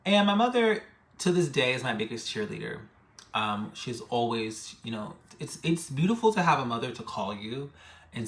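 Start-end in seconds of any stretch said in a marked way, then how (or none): none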